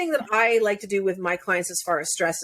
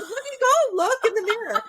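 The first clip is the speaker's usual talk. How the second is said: husband's voice; 13 semitones higher than usual. Retold or imitated. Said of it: "He goes".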